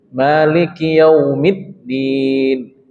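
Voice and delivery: Maliki Yaumiddin very slowly, the double d pressed; doubled d pressed. Shown as a demonstration. Teacher warns against saying Midin, reads it slowly. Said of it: "nggak ada baca Midin".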